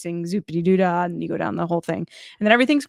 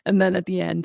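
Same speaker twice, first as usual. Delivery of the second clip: ordinary talk, nothing marked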